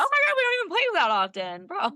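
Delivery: high playful protest at a trash-talker; exasperated comeback. Retold or imitated. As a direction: throw up your hands, teasing. Retold exasperated comeback to player.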